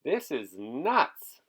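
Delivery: a hint of a fed-up dad; exasperated father. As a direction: drag it out, fed up. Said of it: "said her father".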